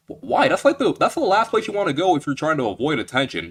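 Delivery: high-pitched disbelieving why; incredulous. Real, not acted. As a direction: react like it makes no sense. Disbelief at the plot choice.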